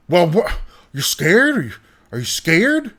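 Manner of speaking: baffled parent probing gently; puzzled dad. Retold or imitated.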